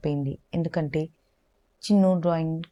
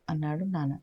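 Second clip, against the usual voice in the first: ordinary delivery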